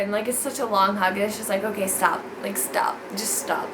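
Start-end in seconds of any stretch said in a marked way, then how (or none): 1.6-3.7 low, soft pleading stop; quiet plea. Retold or imitated. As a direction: beg quietly under your breath. Wanting the hug to end.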